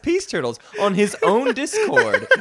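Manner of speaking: high, grand announcer voice, laughing. Acted. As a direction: announce it like royalty, amused. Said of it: "announcing in a grand voice".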